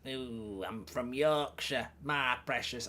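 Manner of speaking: slow, soft creature voice attempt; badly faked creature voice, hushed and halting; Gollum. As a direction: struggle through a quiet, drawn-out impersonation. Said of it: "I can't do Gollum".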